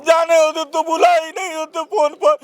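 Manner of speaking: high, crying protest; upset, high-pitched tearful voice. Real, not acted. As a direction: keep talking through real tears. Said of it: "आप रोइए मत".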